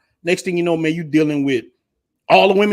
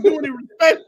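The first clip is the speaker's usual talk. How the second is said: normal talking voice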